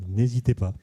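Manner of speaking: normal talking voice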